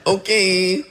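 high, squeaky giving-in "Okay"; resigned okay. Retold or imitated. Preacher agreeing to release the episode, squeaky surrender. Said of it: "And I'm like".

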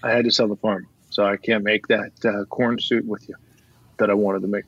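low, soft, dejected admission; downcast confession. Acted. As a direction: break bad news to grandpa, deflated. Grandson confesses he lost the farm.

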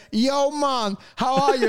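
hammed-up Jamaican greeting; mock Jamaican accent. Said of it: "imagine this guy trying to get a job".

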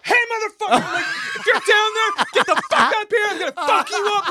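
high, strained mock yell; panicked threat. Retelling his threat to whoever is in the basement, panicked yell. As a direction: imitate a scared bluff at an intruder.

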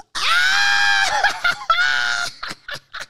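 shrieking high cackle; loud laughing shriek. Real, not acted. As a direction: scream with laughter. Cackling at the story.

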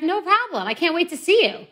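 high, gushing sugary voice; butter him up, all sweetness and eagerness; sweet-talking the driver. Retold or imitated. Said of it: "So I start just messaging back".

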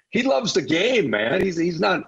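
ordinary delivery

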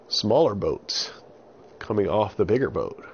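usual speaking voice

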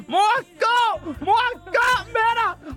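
very high, desperate mock shriek; hammed-up squeal sending mum away; panicked yell at mother. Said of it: "Så siger jeg".

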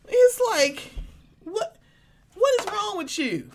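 high incredulous outburst, pitch way up; exasperated disbelief. Real, not acted. Cohost hears her exasperated, high-pitched disbelief. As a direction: throw up your hands, have I lost my mind.

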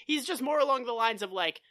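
ordinary talk

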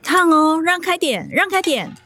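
high-pitched warning call. Acted. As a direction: shoo them out of the way. Mother carrying hot dish, calling out. (妈妈端着热菜喊大家让开)

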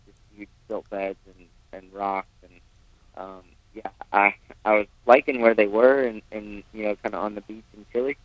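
breaking up, faint phone audio; dropping out on a bad connection; bad phone line. Real, not acted. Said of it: "We've almost completely lost you".

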